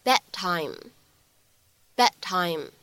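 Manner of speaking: "bad time" with the d not released, drawn out slowly; slow lost-plosion demo. Shown as a demonstration. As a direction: stretch "bad time" and swallow the d. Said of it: "我们呢可以读成是". (失去爆破慢读示范)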